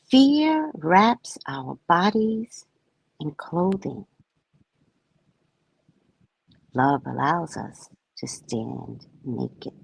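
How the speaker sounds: slow, hushed reading of the book; solemn poetic reading. Acted. Reading her book to listeners, slow and solemn. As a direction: let each word land, reverent.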